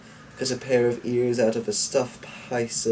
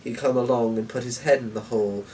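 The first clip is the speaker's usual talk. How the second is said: ordinary delivery, nothing marked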